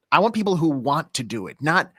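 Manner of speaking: ordinary talk, nothing marked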